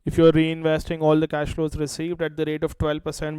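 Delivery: ordinary talk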